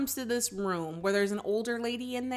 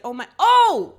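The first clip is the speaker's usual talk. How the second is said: a sudden high, loud oh of joy; delighted gasp; 7 semitones higher, 12 dB louder than usual. Retold or imitated. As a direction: burst out in surprised delight. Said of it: "She's just like".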